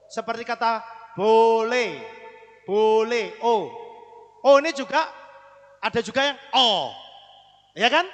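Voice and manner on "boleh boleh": "boleh" slowly, with a stretched O; long O demonstration. Shown as a demonstration. Teacher giving an example of O, drawn out. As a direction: stretch the first syllable of "boleh". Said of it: "dia akan jadi kata O".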